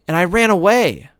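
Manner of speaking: put-on high little boy voice. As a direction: play the young brother, excited. Jamie voiced.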